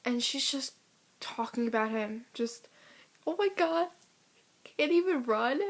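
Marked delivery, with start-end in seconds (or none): 3.3-5.6 high-pitched imitation of a gossip; mocking girl. Ex-friend making fun of a boy who runs, mocking imitation. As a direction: mimic her sneering, pitched up high.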